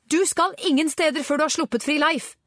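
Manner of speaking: high, forceful demand; heated high-pitched ultimatum; angry shouted threat. A narrator's reading. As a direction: confront them, voice pitched right up. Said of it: "ropte Angela og rettet en dirrende finger".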